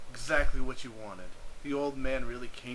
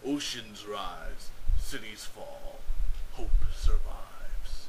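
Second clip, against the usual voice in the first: slow, low, dramatic trailer voice ending in a whisper; movie trailer voice; 4 semitones lower, 6 dB louder, much slower than usual. Retold or imitated. Narrator quoting the Deep Impact trailer, slow dramatic hush. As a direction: intone it like a disaster film trailer, fading to a hush.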